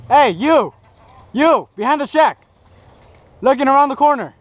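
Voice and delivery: calling out. Real, not acted. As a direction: shout to point out the enemy. Calling out enemy position.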